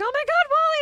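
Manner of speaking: high, loud thrilled squeal; excited squeal. Retold or imitated. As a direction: gush like a starstruck fan. Excited fan meeting mascot.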